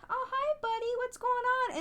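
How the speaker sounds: very high, sing-song coaxing of a dog; coaxing pet voice. Shown as a demonstration. Showing how to call a lost dog, high coaxing voice. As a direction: demonstrate a gentle high call to a stray.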